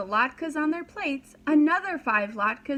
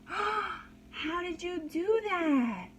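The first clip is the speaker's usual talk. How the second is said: high, slow, wonder-struck; amazed child; 7 semitones higher, much slower than usual. A narrator's reading. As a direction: gasp it out in amazement. Amazed little boys.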